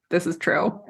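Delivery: ordinary delivery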